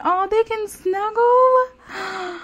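high, drawn-out aww voice; delighted cooing. Real, not acted. Delighted by couple snuggling.

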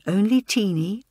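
ordinary delivery

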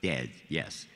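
low, final answer, cutting in; curt dismissal. Retold or imitated. George cuts off the hopeful actor, curt and final. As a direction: drop the pitch and shut the question down.

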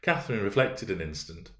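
ordinary delivery, nothing marked

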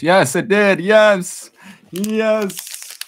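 high, loud triumphant yeses; sevinçli zafer. Real, not acted. Camera finally focuses, celebrating.

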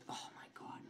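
breathy whisper. Real, not acted. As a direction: whisper in shock. Whispered shock.

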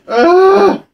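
high, loud startled yelp; sharp yelp. Real, not acted. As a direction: yelp in sudden shock. Sudden hit in game.

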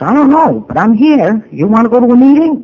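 high, loud shout right back at him; match his yelling word for word. Retold or imitated. [name] shouting back at her drunk sponsor, high and loud. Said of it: "I talked to him the same way he talked to me".

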